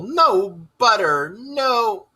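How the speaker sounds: high, slow groaning lament. Acted. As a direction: drag it out in despair. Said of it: "groaned the mole".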